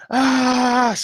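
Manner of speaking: high, held "aah"; epic cry. Acted. Player showing how to rip the horn off, epic high cry. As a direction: let out a long epic cry of effort. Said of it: "gotta epically remove his horn".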